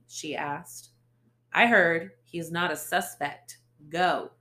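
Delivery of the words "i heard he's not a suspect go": loud, curt dismissal; curt anger. Acted. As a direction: cut her off loudly. Ace dismisses her.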